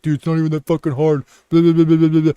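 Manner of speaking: quick, snide know-it-all voice; mocking viewer. Creator mimics a viewer laughing at him, mocking. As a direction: sneer like a smug commenter. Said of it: "somebody watching maybe laughing at me, like".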